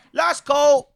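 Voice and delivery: high, loud bartender call of last orders; mock last call. Comedian mocking a bartender, loud mock call. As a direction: bellow last call like a barman closing up. Bartender calls time, mocked.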